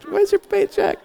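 high, squealing excited wife impression; imitating the wife pouncing on payday; mock-eager payday squeal; excited Nina. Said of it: "then Nina gets really excited".

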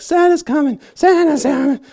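very high, loud, giddy squeal on Santa's coming; squealing overjoyed elf; excited Buddy the Elf. Retold or imitated. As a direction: bounce with childlike glee as the elf. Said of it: "He gets all excited".

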